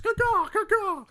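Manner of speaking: high bird caw; phoenix cry. Pretend. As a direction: squawk like a bird. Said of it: "The Phoenix returns".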